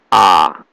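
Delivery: A dipping low, then turning up; third tone. Shown as a demonstration. Teacher showing students the tones, dipping third-tone A. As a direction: drop A down low before the upturn. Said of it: "Third tone is going down".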